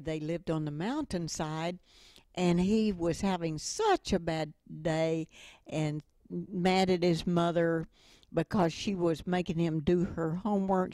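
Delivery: normal talking voice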